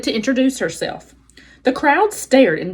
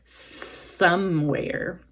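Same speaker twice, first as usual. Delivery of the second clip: slow, soft, lower snake hiss; 4 semitones lower, 6 dB quieter than usual. Acted. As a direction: hiss it slowly to yourself. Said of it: "Snakes often hissed to themselves".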